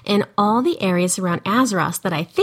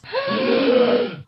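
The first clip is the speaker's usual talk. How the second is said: excited gasp. Acted. Excited podcast reveal.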